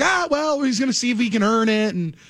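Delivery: high, cautious executive voice; imitating Chris Ballard. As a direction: hedge it like a careful GM. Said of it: "Chris Ballard's like".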